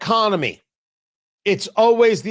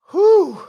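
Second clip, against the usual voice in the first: big breathless whew; loud exhale; 12 semitones higher, 6 dB louder than usual. Real, not acted. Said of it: "I'm winded".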